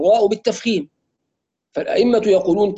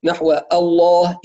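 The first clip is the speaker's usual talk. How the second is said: ordinary talk, nothing marked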